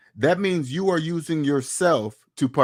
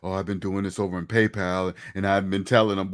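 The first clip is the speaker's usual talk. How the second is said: low mock voice; 8 semitones lower than usual. Voicing a scared tax dodger.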